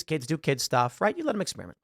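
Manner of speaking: ordinary delivery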